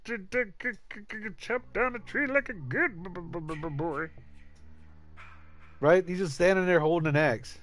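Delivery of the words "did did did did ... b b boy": sad stuttering Jason. Retold or imitated. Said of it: "He looks sad right there".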